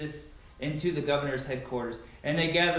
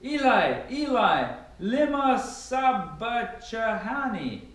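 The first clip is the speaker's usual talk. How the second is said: high anguished cry, slow on the last words; a pleading loud wail, drawn out at the end; 6 semitones higher, much slower than usual. A narrator's reading. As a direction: call out to God in loud anguish. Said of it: "cried out with a loud voice".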